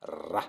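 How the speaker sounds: Ra with a trilled, rolled R. Shown as a demonstration. Teacher showing French speakers how to roll the R in Ra. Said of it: "apprenez à rouler les R".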